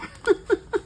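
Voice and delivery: short high giggle; high laugh. Real, not acted. Amused by her sighing dog, a short high laugh. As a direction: laugh lightly at the dog's sigh.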